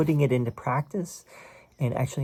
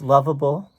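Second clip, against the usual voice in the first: "lovable" said louder with a rising tune; stress on lovable; 7 dB louder than usual. Shown as a demonstration. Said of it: "Emphasis on both of those words".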